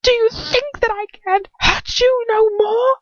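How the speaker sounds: high, loud, near-screaming fury; furious rant. Acted. Lucius rages at his captive, high and screaming. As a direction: rage down at a prisoner who should grovel.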